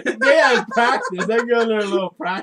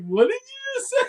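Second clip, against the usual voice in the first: much higher, squeaky imitation of a stunned guy; mock outraged disbelief. Friend imitating a stunned gamer, squeaky mock disbelief. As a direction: squeak it out like you can't believe your ears. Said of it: "The one guy was like".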